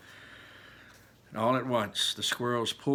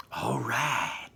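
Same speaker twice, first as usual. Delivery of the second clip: whisper. Acted. Veruca sneaks toward the squirrel.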